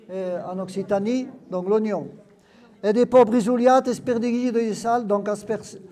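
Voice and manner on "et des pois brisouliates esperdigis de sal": Occitan words read out, louder; lecture en occitan. Acted. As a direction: read the patois dishes aloud. Lit un texte occitan. (lecture appuyée en occitan)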